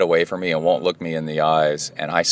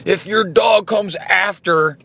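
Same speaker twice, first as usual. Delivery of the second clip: slow shouted threat, much higher; angry threat; 12 semitones higher, slower than usual. Retold or imitated. Old neighbor shouting a threat at the dog owner, furious. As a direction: bellow it like a furious big neighbor. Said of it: "the old guy goes".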